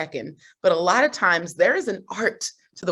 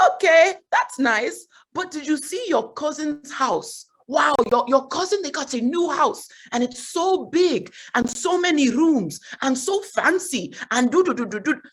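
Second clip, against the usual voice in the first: higher-pitched Nigerian mom impression; Nigerian accent; 7 semitones higher than usual. Mom bragging to daughter about cousin's house, in Nigerian accent. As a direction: imitate a Nigerian mother gushing over a relative. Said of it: "about to do the accent. She's Nigerian".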